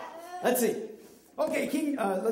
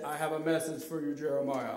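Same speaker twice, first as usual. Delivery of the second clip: low, soft, quick model of God's voice; feed the line in a deep register; lower, restrained voice of the Lord; deep booming voice; 7 semitones lower, 6 dB quieter, faster than usual. Shown as a demonstration. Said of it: "Does that sound like a deep, booming voice?".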